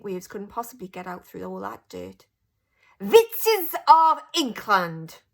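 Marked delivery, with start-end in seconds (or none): 3.0-5.2 slow, high, loud witch screech; Grand High Witch calling her witches to order; shouted. A narrator's reading. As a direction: summon the whole hall in a foreign witch voice. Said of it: "shouted the Grand High Witch".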